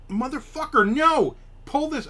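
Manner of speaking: high, loud angry yell; screaming at your girlfriend to pull it off; furious yell. Retold or imitated. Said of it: "I was so pissed at her".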